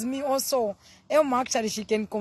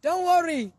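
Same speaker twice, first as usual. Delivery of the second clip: loud praise; 8 semitones higher, 6 dB louder, slower than usual. Real, not acted. Praising God, testimony.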